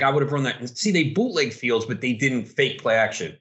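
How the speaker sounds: ordinary delivery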